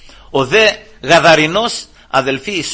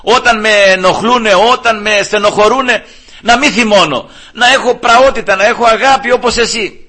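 high, louder, pleading plea to the Lord; pleading prayer; 7 semitones higher, 6 dB louder than usual. Retold or imitated. Preacher voicing his own prayer to the Lord, high pleading. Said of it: "λέω".

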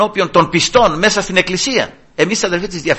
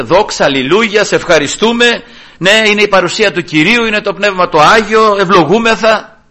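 higher, lifted church-worship voice; imitated fervent praise; 6 semitones higher, 4 dB louder than usual. Preacher acting out believers worshipping inside church, fervently. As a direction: mimic a congregation caught up in praise.

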